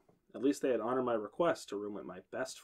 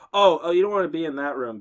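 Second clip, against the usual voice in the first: interrupting warning; 3 semitones higher, 8 dB louder than usual. A narrator's reading. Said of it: "Beth interrupted".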